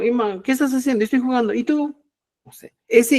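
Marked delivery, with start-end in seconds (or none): 0.0-2.0 higher-pitched, quick question and answer; breezy higher-pitched role-play of a chat; sample dialogue. Acted. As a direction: act out a little everyday exchange. Acts out a sample exchange.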